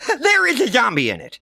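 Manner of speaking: put-on high, loud kid insisting; earnest kid. Johnny insists to his sister, high and earnest. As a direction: insist it's true.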